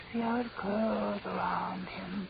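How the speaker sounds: breathy, unvoiced, dazed whisper; a mad girl whispering to her captor; eerie whisper. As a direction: whisper it as if seeing a ghost. Ellen raving about the smoke.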